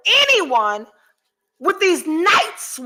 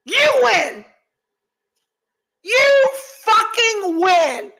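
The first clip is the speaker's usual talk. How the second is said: defiant shout; 5 semitones higher, slower than usual. Real, not acted. Conceding to the haters, defiant.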